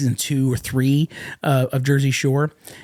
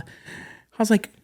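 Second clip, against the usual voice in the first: ordinary talk, nothing marked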